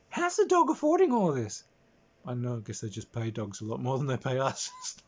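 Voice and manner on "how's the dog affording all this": much higher, louder incredulous question; baffled. Acted. Player wondering how the dog affords luxuries, high and incredulous. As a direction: squeak it in disbelief.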